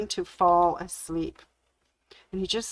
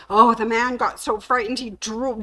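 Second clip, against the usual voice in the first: ordinary delivery, nothing marked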